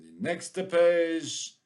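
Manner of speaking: slow mock French accent. Teacher announcing the next page, mock French accent. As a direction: stretch it out in a put-on French accent. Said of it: "hear me speaking the French".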